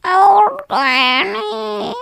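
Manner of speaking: put-on high, slow, quavering old granny. Shown as a demonstration. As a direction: creak it out like a tiny old granny. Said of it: "I have the best old granny voice".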